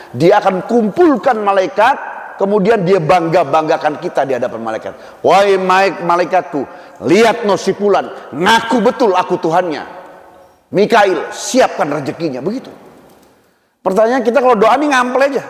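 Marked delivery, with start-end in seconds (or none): 5.2-12.4 higher, proclaiming voice calling the angels; proud divine address. Acted. Voicing God praising servant to angels.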